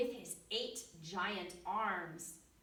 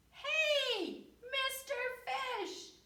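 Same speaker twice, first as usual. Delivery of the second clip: much higher, louder floaty call; high jellyfish call; 4 dB louder, slower than usual. Acted. Jellyfish calling to the fish, high and bright. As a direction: sing out the greeting up high.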